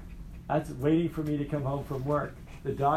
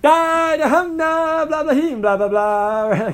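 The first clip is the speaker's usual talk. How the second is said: much higher, loud silly babble; mock gibberish; 13 semitones higher, 10 dB louder, slower than usual. Teacher mocking a meaningless answer to his daughter, loud nonsense babble. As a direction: make the answer sound like empty noise. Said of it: "I could have been like".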